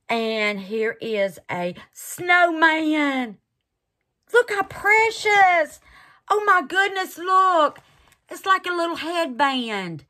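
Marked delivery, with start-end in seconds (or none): 4.3-10.0 high and loud, doting; squealing at the precious mold; cooing delight. Real, not acted. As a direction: coo over something adorable. Cooing over snowman mold.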